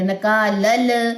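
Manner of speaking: نکالل held very slowly, lam without bounce; drawn-out demo. Shown as a demonstration. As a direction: stretch the final ل of نکالل smoothly, no echo. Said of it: "کلکلے نہیں کرنے". (نکالل آہستہ، بغیر قلقلہ)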